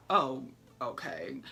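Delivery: slow, low, deadpan oh okay; unimpressed. Retold or imitated. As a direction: drag it out, unconvinced.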